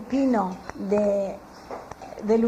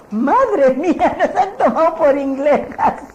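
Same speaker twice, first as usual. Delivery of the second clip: amused surprise; 4 semitones higher, 6 dB louder than usual. Retold or imitated. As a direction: marvel at it with a laugh. Her amazed reaction retold. (asombro divertido, más fuerte y lento, entre risas)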